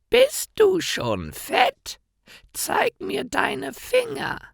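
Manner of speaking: very high, slow witch voice, greedy; cackling witch. A narrator's reading. Witch checks whether Hänsel is fat, high and greedy. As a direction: leer at the boy through the bars.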